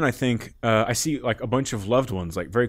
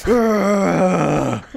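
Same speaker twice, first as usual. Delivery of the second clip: overwhelmed groan; 9 semitones higher, 6 dB louder than usual. Real, not acted. Overwhelmed by the fierce love.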